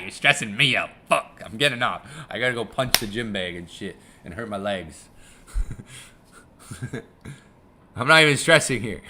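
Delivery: normal talking voice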